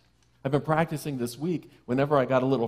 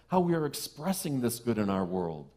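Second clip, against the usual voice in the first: usual speaking voice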